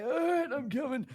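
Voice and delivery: put-on high, strained old man getting up; hammed-up old man struggling up; old man groan voice. Old man noise while getting up.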